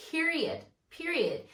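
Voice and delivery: high, falling PERIOD said twice; emphatic period. Real, not acted. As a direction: land period hard, twice. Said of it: "emphatic repetition".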